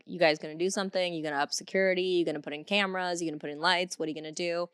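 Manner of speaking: rapid-fire string of questions; quick, insistent retold nagging; relentless pestering. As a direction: reel off demands one after another, fast. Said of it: "emailing the actual r-, rental company relentlessly".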